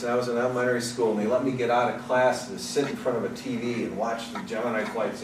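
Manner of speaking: ordinary delivery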